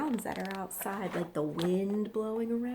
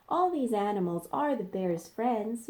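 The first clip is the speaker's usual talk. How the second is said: plain reading voice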